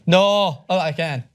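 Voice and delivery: ordinary talk, nothing marked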